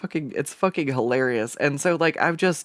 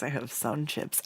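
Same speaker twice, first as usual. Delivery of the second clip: usual speaking voice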